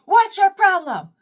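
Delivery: high, loud confrontational yell; hammed-up furious shout; going off. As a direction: demand it at full volume, way up high. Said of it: "I go off, y'all".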